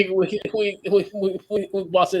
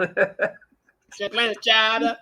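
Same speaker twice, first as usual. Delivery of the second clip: higher, slow mock Boston accent; 4 semitones higher, much slower than usual. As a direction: laugh through a hammed-up Boston accent. Echoes the Boston accent joke.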